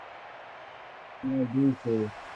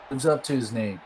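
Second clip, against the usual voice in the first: ordinary talk, nothing marked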